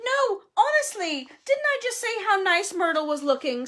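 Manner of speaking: high, anxious protest, easing down; insistent denial. A narrator's reading. Hermione prompting Ron and Harry to back her, insistent.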